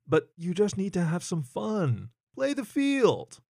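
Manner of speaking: lazily urging a friend to have fun; hammed-up carefree advice; teasing hyena. Hyena coaxing his friend.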